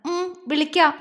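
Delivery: a touch of tears in the voice; sobbing, understated. A narrator's reading. Jwala promises Ponnu a call, mildly tearful. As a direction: agree through a held-back sob. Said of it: "ജ്വാല തേങ്ങി".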